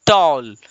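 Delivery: "टॉल" in a single falling beat; one unbroken syllable. Shown as a demonstration. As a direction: say "टॉल" all in one go, no split. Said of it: "तो एक ही बार में बोला गया". ("टॉल" को एक ही बार में, बिना तोड़े)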